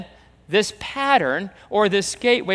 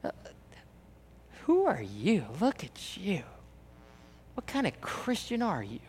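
soft, contemptuous put-down; disdainful sneer; 9 dB quieter than usual. Retold or imitated. Church members sneering at each other, soft contempt. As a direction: look down your nose at them. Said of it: "just going".

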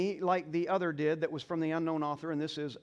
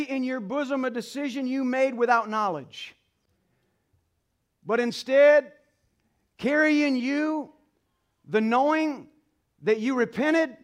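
louder, higher preacher's cry; preaching at full force; 7 semitones higher, 8 dB louder than usual. Acted. Pastor urging the mothers in the congregation, preaching loudly. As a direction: lift the church with rising conviction.

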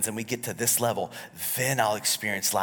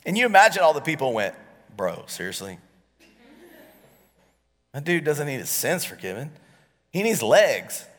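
loud, higher, driving sermon voice; preaching at full force; 7 semitones higher, 8 dB louder than usual. Acted. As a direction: preach it out to the whole room.

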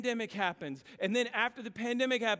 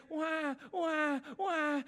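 high mock newborn wail, wah wah; baby crying imitation; 5 semitones higher, much slower than usual. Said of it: "I hear".

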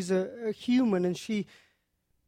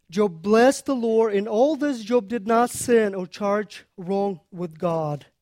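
preaching; 5 dB louder than usual. Acted. Preacher proclaims scripture.

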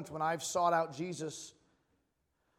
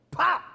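slap sound; 9 semitones higher, 10 dB louder than usual. Retold or imitated.

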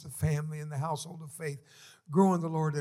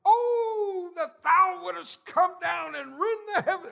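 high, loud crying-out of scripture; dad's sudden shout; 8 dB louder, slower than usual. Retold or imitated. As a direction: belt the verse out like an old preacher waking. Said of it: "Suddenly I hear".

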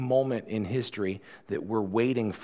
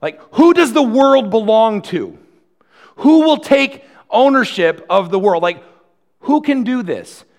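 impassioned preaching; 9 semitones higher, 11 dB louder than usual. Real, not acted. Preacher pressing the angel's question.

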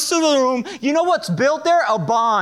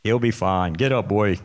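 low, gruff, offhand dad voice; dad telling the crying son to get up, in a gruff low mock voice. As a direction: play the tough dad brushing off the crying baby. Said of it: "No, I'm just kidding".

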